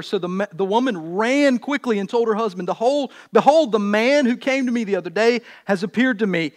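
projected, measured reading with weighted key words; scripture reading. Acted. Preacher reads Samson's birth story, scripture reading. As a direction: proclaim the Bible story to the room.

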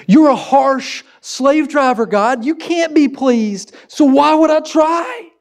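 high, slow, rising protest; exasperated complaint. Acted. People complaining to God they can never please, exasperated. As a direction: throw up your hands at an impossible taskmaster, pitched high.

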